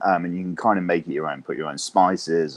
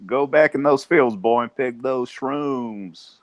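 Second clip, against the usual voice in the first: higher, louder, then slow Southern drawl; Southern twang; 6 semitones higher, 4 dB louder, slower than usual. Pretend. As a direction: call him back to the fields in a yokel drawl. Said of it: "You got a little bit of North Carolina twang in there".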